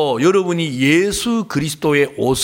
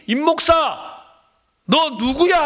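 God asking; 8 semitones higher than usual. Retold or imitated. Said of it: "하고 물어보면".

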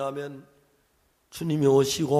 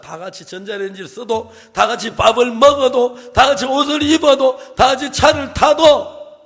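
rousing build; 5 semitones higher, 5 dB louder than usual. Acted. Preacher builds rousing exhortation.

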